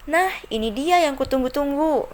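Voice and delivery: excited exclamation. Shown as a demonstration. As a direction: greet the long-awaited thing with delight.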